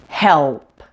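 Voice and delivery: HELP said slowly with a long dark L before the P. Shown as a demonstration. Teacher leading repeat-after-me, drawn-out dark L in HELP. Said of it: "pronounce those after me".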